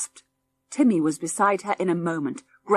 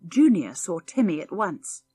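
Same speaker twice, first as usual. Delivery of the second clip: plain reading voice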